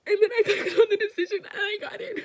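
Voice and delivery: high, loud, breaking into laughter; can't hold back the joy; laughing with excitement. Real, not acted. Telling the camera she got in, laughing loudly.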